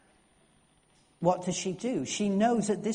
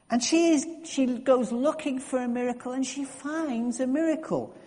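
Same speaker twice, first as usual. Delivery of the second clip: rising excitement; 6 semitones higher than usual. Real, not acted. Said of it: "emphatic sermon climax".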